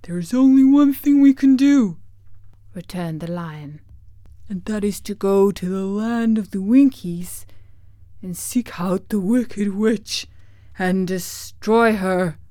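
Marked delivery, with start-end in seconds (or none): 0.0-2.0 loud, high, declaiming lion voice; big theatrical beast voice; Cowardly Lion. Acted. 4.5-12.4 declaiming lion voice, slowing at the end; firm, theatrical beast voice; Cowardly Lion. Acted. Lion sets out the plan.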